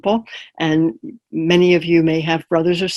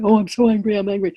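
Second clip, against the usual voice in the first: higher-pitched, lightly hammed-up complaint; mildly exaggerated angry self-talk; mock angry; 3 semitones higher, faster than usual. Shown as a demonstration. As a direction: demonstrate being caught up in anger. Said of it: "it's not being angry that I'm angry".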